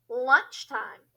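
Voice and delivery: ordinary delivery, nothing marked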